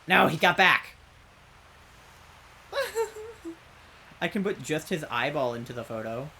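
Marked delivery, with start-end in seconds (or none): 0.1-0.9 frustrated exclamation. Real, not acted. Follower returns, loud. 2.7-3.5 high wailing laugh; high crying laugh. Real, not acted. Laughing at failure.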